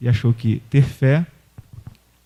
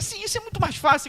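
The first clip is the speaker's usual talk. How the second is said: very high, squealing with joy; mock-thrilled high squeal of triumph; excited squealing; 4 dB quieter, faster than usual. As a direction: shriek it out like a thrilled little girl. Woman relieved, eager.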